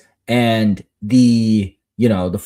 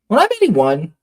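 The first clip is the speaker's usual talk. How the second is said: lightly imitated daughter, pitched up; a hint of a hopeful kid's higher voice; child asking; 7 semitones higher than usual. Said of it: "but she'll be like".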